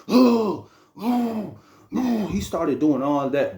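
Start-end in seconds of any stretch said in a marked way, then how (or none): 0.1-2.3 pump-up grunts. Retold or imitated. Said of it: "making a whole bunch of noise".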